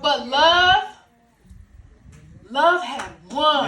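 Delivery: triumphant climax. Acted. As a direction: ring out the victory to a cheering crowd. Climax of church monologue.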